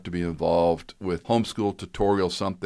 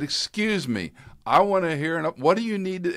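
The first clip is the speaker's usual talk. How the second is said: insistent dad; 11 semitones higher than usual. Retold or imitated. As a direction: build the pressure line by line.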